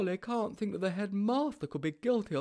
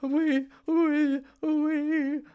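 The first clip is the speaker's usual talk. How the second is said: much higher, louder panicked stammer; burbled; 8 dB louder, much slower than usual. A narrator's reading. Sponge caught out by the headmaster, burbling in fright. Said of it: "burbled Sponge".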